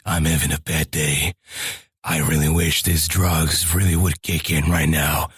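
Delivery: very low game-hero voice; Max Payne. Shown as a demonstration. Imitating a game character having a bad day, very low. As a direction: drop way down into a brooding action-hero monologue. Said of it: "Max Payne and whatnot, when they talk".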